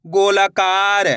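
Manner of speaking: गोलाकार very slow, all vowels stretched; joined-up demonstration. Shown as a demonstration. Teacher blending the letters for learners, गोलाकार slowly drawn out. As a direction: draw out गोलाकार, especially कार. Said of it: "तो मिलके बन जाएगा".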